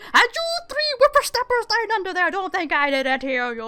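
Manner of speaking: put-on loud, high-pitched old man scolding; gruffly protective old driver. Old guy warning three hiding under his cart, loud scolding. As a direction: scold stowaways as a cranky old carter. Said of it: "the old guy goes around".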